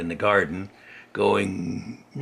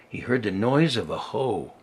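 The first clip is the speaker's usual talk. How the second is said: plain reading voice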